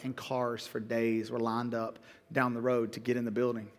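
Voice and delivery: low, soft, choked-up telling; real, restrained emotion; emotional. Real, not acted. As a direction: drop low and quiet, holding back emotion. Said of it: "when I get emotional".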